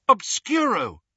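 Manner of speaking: high, loud spell incantation; sharp rise in pitch and volume, casting; cried out. A narrator's reading. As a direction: cry out the spell with force. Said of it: "Hermione cried".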